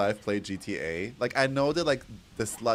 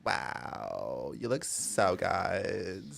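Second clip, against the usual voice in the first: put-on valley girl; 3 semitones higher, slower than usual. Streamer as valley girl admiring makeup, valley girl voice. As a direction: gush in valley-girl speak, dropping into drawn-out fry. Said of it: "Can I request a valley, girl?".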